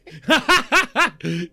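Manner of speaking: big loud laugh; loud burst of laughter. Real, not acted.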